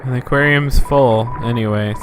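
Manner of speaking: ordinary talk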